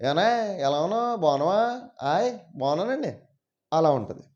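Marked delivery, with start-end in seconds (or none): 0.1-3.1 high, sing-song Srikakulam greeting; demonstrated Srikakulam slang, pitched up; Srikakulam accent. Shown as a demonstration. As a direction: imitate Srikakulam Telugu with rising lilt. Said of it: "Srikakulam ఇంకా అటువైపు వెళ్ళారనుకోండి".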